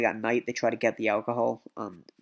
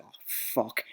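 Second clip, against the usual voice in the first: hushed dismay; 2 semitones lower, 7 dB quieter than usual. Retold or imitated. Group dismayed, caught.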